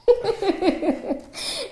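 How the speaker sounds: a high, rising burst of laughter; high amused laugh. Real, not acted. Laughs at friend's anger story.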